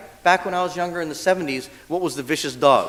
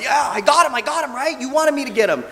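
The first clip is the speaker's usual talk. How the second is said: high, eager, pleased-with-itself voicing; a dog bragging to its owner; dog boasting about the bite; proud dog voice; 9 semitones higher than usual. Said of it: "the dog's all like".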